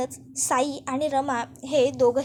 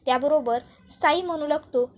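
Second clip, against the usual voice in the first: ordinary delivery, nothing marked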